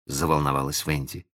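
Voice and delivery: usual speaking voice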